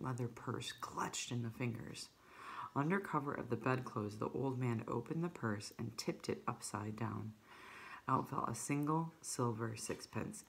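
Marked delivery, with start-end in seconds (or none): none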